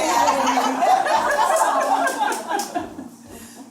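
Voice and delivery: high loud burst of laughter; big laugh. Real, not acted. Laughs at son's interruption.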